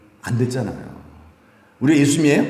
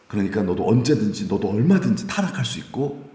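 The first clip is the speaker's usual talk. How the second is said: ordinary talk, nothing marked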